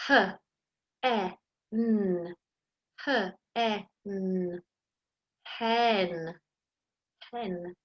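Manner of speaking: quiet, fading letter sounds; sounding out. Shown as a demonstration. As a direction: sound out h, e, n, then blend hen. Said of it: "It's a".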